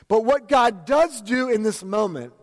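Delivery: ordinary talk, nothing marked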